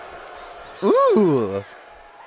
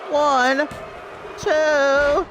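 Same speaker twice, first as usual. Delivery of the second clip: play-by-play commentary; 13 semitones higher than usual. Acted. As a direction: call the match like a ringside announcer.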